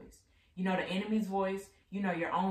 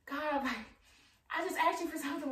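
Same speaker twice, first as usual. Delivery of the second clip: high, fast complaining whine; mock entitled complaint, pitched up; exasperated plea to God; 10 semitones higher than usual. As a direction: whine at God like a spoiled kid. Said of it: "I'm like".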